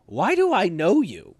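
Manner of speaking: a hint of a suspicious drill sergeant; imitated drill sergeant. Drill sergeant confronting an unknown recruit, imitated suspicious demand.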